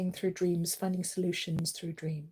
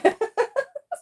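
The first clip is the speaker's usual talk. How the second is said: high delighted laugh; 8 dB louder than usual. Real, not acted.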